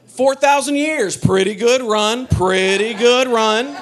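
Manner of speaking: slow, loud mock salute; drawn-out grand pronouncement; mock solemn tribute. Mock tribute to Chinese history.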